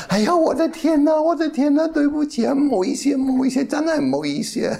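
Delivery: high, loud embarrassed Cantonese apology; flustered apology. Retold or imitated. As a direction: squeal it out in shock and embarrassment. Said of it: "傻掉了".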